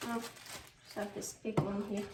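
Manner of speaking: usual speaking voice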